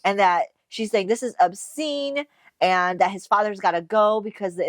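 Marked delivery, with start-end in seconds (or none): 1.1-2.2 slow, higher indignant quote; mildly indignant, drawing out OBSCENE; mock scandalized complaint; retold outrage. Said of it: "comes over yelling at Draco".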